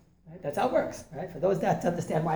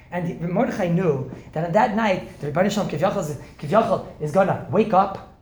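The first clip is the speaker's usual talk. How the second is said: ordinary talk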